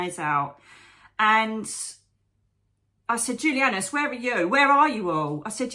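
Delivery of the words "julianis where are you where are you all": higher, louder calling out in exasperation; raised, exasperated demand, retold; retold angry plea. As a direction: re-enact calling out angrily for absent helpers. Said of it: "I was so angry".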